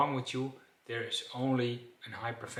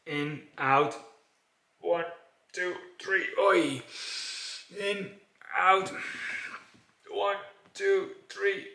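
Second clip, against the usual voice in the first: high, strained count between short gasps; gasping emergency count; 6 semitones higher, 4 dB louder than usual. Shown as a demonstration. Showing the class how to count out of breath, high and gasping. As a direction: count through breathlessness, pushing the pitch up. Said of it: "and then you do like this".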